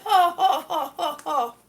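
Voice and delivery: bitter ho-ho laugh, falling away; incredulous laugh. Real, not acted. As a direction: laugh in disbelief at the damage. Finds another smashed tile.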